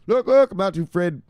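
high, loud hype voice; high-pitched catchphrase call; hyped shout-out. Pretend. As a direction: announce it way up high with punch. Calling out a follower.

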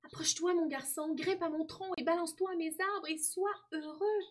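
high, joyful tree voice; trembling with joy. A narrator's reading. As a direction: welcome the boy back, lifted high throughout. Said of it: "l'arbre tremblant de joie, et dit". (voix haute, tremblante de joie)

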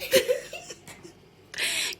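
bursting high laugh. Real, not acted. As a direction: laugh out in relief. Laughs after the prank.

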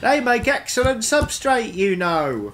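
high, chirpy moss voice; high, smug talking moss. Acted. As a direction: share a dark secret cheerfully. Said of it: "the moss pipes up and goes".

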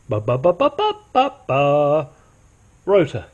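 playful "ba, ba, ba" drumroll with a jump up on the second "ba"; mock fanfare before "rotor"; vocal drumroll. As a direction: build up to the reveal like a fanfare. Playful drumroll announcing new rotor.

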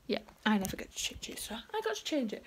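soft unvoiced whisper. Real, not acted. Vlogger whispers to camera while changing the advent number. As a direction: keep it hushed throughout.

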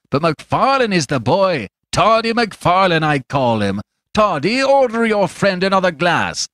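high, loudish taunting rogue; domineering, coarse stranger. A narrator's reading. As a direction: order him about mockingly, pitched well up.

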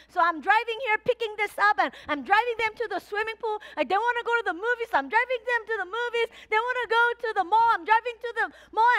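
high, fast, breathless listing; frantic rush. Acted. Pastor telling the church about her hectic week, fast and high. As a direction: pile it on like a frazzled mom.

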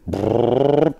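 buzzing "brrr" with the lips; lip-trilled mechanical rumble; imitated motor noise. Imitates noise during the joke.

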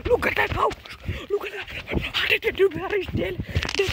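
very high, loud scared yelling; panicked high shriek. Real, not acted. Panicked shouting at the house.

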